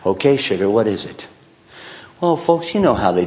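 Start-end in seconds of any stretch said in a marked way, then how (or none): 0.1-1.3 lower, mildly grudging reply; understated put-upon husband. Retold or imitated. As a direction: give in with a touch of reluctance. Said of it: "not with the best attitude".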